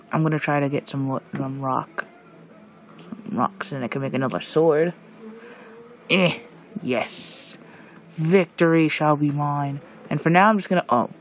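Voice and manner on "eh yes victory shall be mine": ordinary talk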